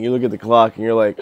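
ordinary talk

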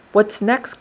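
high-pitched, eager cry; cheerful and excited. A narrator's reading. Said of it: "cried the now cheerful and excited pencil".

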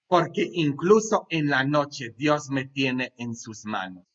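ordinary delivery

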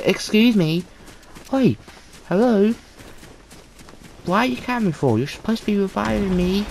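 loud high outraged call; indignant shout. Real, not acted. Player yelling at teammates who won't revive him, outraged. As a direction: demand they help him.

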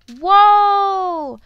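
long, high, very loud exclamation; amazed whoa. Real, not acted. Player amazed by shipwreck treasure, loud astonished whoa. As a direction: cry out in big astonishment.

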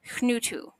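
"hnutu" opening on a soft breath into the N; breath before N. Shown as a demonstration. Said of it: "This is a soft breath".

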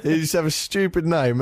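usual speaking voice

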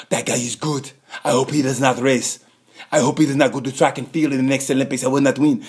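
put-on African accent, level and measured; mock African accent, evenly spaced words. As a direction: imitate a sprinter with an African accent. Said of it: "that was an African accent".

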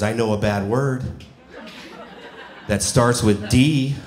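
solemn imitation of a child confessing; slow down gravely toward the letter; all serious. Nephew confiding a bad word to his uncle, mock all serious. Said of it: "he looks at me all serious".

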